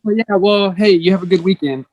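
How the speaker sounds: breezy, high-pitched mock dismissal that changes the subject; dismissive brush-off. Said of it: "Don't be like".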